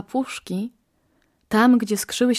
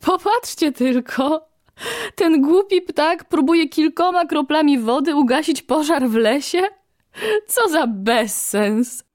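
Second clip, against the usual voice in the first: higher, scornful mocking voices, dragged out at the end; mocking exclaim; 6 semitones higher than usual. Gods mocking the little bird, higher scornful exclaiming. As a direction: jeer at the silly bird from above. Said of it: "wykrzykiwały".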